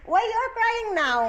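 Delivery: high questioning. Real, not acted. Mother asks crying child.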